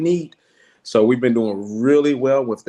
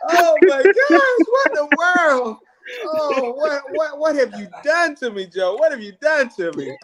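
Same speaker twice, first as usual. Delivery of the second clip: much higher, hammed-up disbelief; mock outrage; 11 semitones higher, 4 dB louder than usual. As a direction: squeal the protest in playful outrage. Mock protest at following others.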